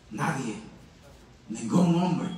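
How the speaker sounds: very low, soft single word; hushed answer. Acted. Preacher soberly confirming no one can, hushed answer. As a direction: let the answer fall quietly.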